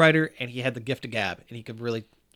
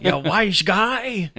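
very drawn-out slapstick impression, pitched up; hammed-up higher comic taunt; Three Stooges; 6 semitones higher, 4 dB louder, much slower than usual. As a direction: imitate a stooge, stretching each word way out. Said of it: "speak Three Stooges lines".

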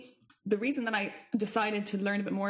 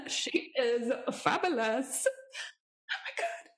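gushing awe; 4 semitones higher, slower than usual. Real, not acted. Gushing about lecturer.

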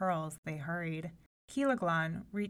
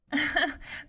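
high light giggling; girlish giggle; 8 semitones higher, 4 dB louder than usual. Acted. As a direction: giggle like a mocking princess. Princesses laugh at him.

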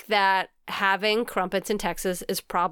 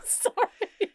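laughing; 12 semitones higher, 3 dB quieter than usual. Real, not acted. Cracking up mid-show.

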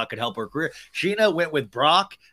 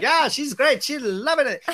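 very high, hammed-up enthusiast; high-pitched, exaggerated mock cheer; horrible Brock voice; 12 semitones higher, 5 dB louder than usual. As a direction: badly imitate a gushing supportive boyfriend. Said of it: "that's my horrible Brock voice".

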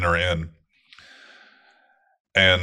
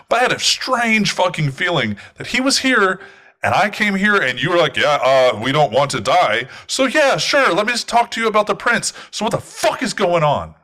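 rising fury; 10 semitones higher, 3 dB louder than usual. Acted. The woman ranting at R Q about the kidnappings, building anger. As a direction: pitch climbs far higher as her anger boils over. Vampire woman's angry rant peaks.

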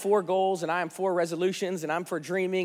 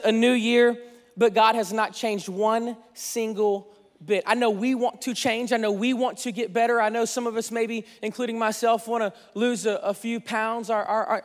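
usual speaking voice

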